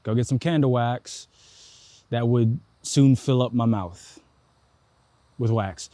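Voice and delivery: normal talking voice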